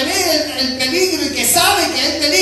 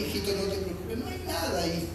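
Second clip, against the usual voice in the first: soothing parent; 7 semitones lower, 14 dB quieter, faster than usual. Retold or imitated. Parent comforting scared child.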